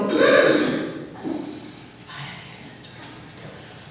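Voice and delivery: slow, breathy whisper of exasperation; weary whispered thought. Retold or imitated. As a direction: mutter it to yourself, worn out. Voicing weary inner thought.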